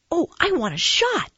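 put-on high-pitched, excited kid voice; eager child. Boy begging the nurse for a shot, high and eager.

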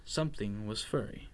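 plain reading voice

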